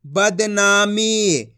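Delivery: very slow, syllable by syllable "बदनामी"; sounds joined slowly. Shown as a demonstration. Teacher blending the letters into a word, very slowly. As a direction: draw out each syllable of "बदनामी". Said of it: "तो मिलके बन जाएगा".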